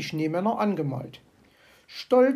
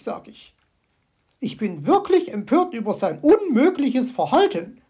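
indignant magpie chatter; 2 semitones higher, 6 dB louder than usual. Acted. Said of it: "schwatzte sie vor sich hin".

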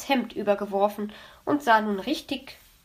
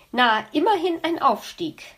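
higher-pitched, mildly indignant comeback; huffy retort; 6 semitones higher, 4 dB louder than usual. A narrator's reading. Luzi retorting to Kitty's teasing, huffily. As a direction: throw it back with a touch of huff. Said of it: "fauchte Luzi".

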